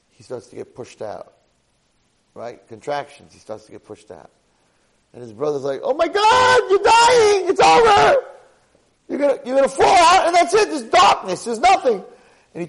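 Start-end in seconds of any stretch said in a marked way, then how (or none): 5.8-12.0 very high, loud panicked shriek; panicked screaming. Acted. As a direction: scream it in mock terror as the abandoned twin. Brother panics as twin is born.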